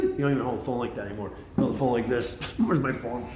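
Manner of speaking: normal talking voice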